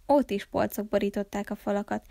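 ordinary delivery

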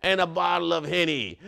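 hammed-up pirate with stretched words; pirate talk. Celebrating National Talk Like a Pirate Day, pirate voice. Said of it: "National Talk Like a Pirate Day".

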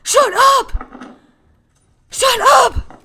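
very loud, high-pitched screams; real screaming. Real, not acted. Screaming over the kid.

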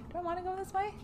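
high, soft put-on voice asking which way; silly high voice. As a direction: squeak the question like a cartoon. Asks herself in a put-on voice.

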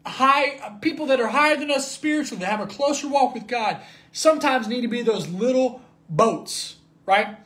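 rising, fervent sermon voice; high, pressing preacher's cadence; preaching. Acted. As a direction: preach it out, building to the crowd. Preaching to congregation.

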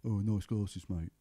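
lightly voiced taunting troublemaker; drunk scally lad. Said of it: "some little drunk scally lad".

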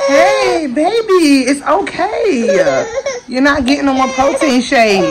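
high, slow comforting coo; tender, high-pitched reassurance. Real, not acted. Soothing crying niece.

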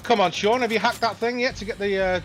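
loud, high and impatient; impatient urging. Real, not acted. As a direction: hurry him up. Hurrying teammate in combat.

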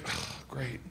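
soft, low sigh of a reply; resigned aside under the breath; deflated. Retold or imitated. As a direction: mutter it, utterly underwhelmed. Underwhelmed reply in retold exchange.

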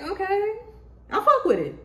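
high, comic little voice; playful approving voice. Acted. Reacting to the weird genuine friend, playful high approval. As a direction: pitch it up, amused acceptance.

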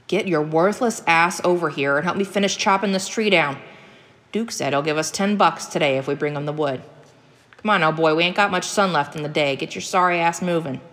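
impatient backwoods father giving orders; gruff hunter father. Acted. Hunter father ordering his boy to help chop a tree, impatient. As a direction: order him over with rough contempt.

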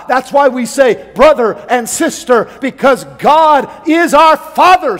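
loud, high preaching voice; full-force preaching. Acted. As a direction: preach it out to the whole church.

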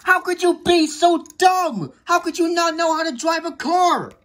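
high, furious telling-off; exasperated angry outburst; aggressive scolding. Acted. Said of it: "stop being aggressive towards Mater".

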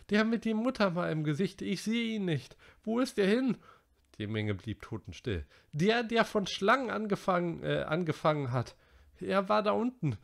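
put-on high, anxious boy's voice; Piggy frightened. A narrator's reading. Piggy searching for the missing little boy, high and alarmed. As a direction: ask in rising alarm.

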